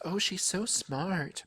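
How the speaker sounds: soft, slow, gushing mother's voice; admiring mother. A narrator's reading. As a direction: gush over her quietly and slowly. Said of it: "my mother says".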